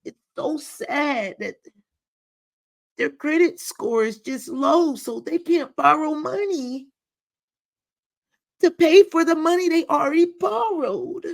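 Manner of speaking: high, sugary pity voice, mimicking her; mock pitying voice. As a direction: parody her sad-sounding tone, high up. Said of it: "That's how she sounded".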